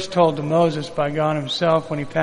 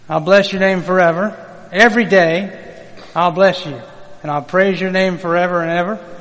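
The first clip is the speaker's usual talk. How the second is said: raised, louder psalm reading; scripture reading; 3 semitones higher, 5 dB louder than usual. Acted. Pastor reads the psalm to his congregation, proclaiming.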